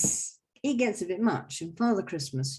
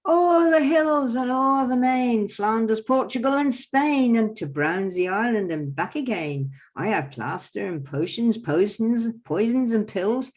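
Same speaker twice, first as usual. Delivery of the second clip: the quack doctor boasting; 3 semitones higher, 6 dB louder than usual. Acted. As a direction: brag to the crowd like a travelling quack. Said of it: "So I go".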